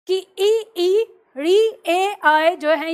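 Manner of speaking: slow, drawn-out front vowels ई, री, ए, आए; vowels drawn out one by one for the class; vowel demo. Shown as a demonstration. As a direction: stretch each vowel ई, री with spread lips. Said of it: "अब practical करके देख लो".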